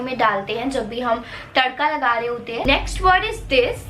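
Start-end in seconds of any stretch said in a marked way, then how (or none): none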